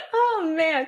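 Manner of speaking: high, laughing exclamation; real laughing. Real, not acted. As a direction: say it through laughter. Laughing at the acted outburst.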